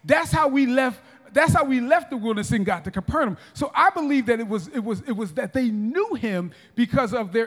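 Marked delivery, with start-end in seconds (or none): 0.1-3.4 demons confronting Jesus. Acted. Demons telling Jesus they remember the wilderness, accusing. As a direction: stretch out the accusation, then pick up pace. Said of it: "they were able to say".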